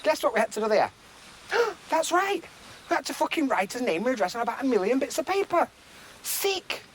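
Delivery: high, mock-amazed sarcasm with a gasp; sarcastic. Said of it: "so you can see my sarcastic face".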